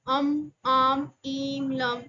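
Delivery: slow drawn-out examples. Shown as a demonstration. Examples of nasal sounds.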